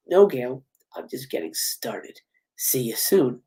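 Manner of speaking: deep, hushed, slowing menace; Ghostface. Acted. Killer signs off his call to Gail, low and threatening. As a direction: sink low and soft, in no hurry. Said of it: "getting a call from Ghostface".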